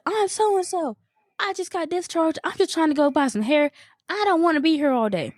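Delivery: high-pitched mock frustration; high, put-on exasperated voice; frustrated patient. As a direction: imitate her fed-up protest, pitched well up. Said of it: "just getting frustrated".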